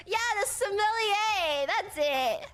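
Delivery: high, loud put-on connoisseur voice; mock connoisseur. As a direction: sound like a snobbish expert. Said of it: "You have to be like".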